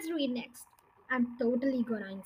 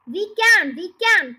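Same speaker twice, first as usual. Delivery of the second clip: louder, insistent cry; cried; 4 semitones higher, 11 dB louder than usual. Acted. Muggle Wump insisting to the doubting bird, loud cry. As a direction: insist it loudly twice. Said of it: "cried the Muggle Wump".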